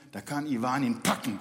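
ordinary delivery

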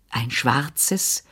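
low, tentative, hopeful question; understated hope. A narrator's reading. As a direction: ask it quietly, with a little hope. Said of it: "fragte ich hoffnungsvoll".

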